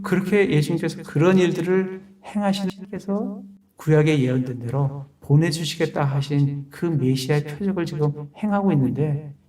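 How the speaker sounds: ordinary delivery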